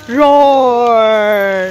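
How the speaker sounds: put-on low growly roar for the child; playful dinosaur roar. Shown as a demonstration. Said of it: "What does the dinosaur say".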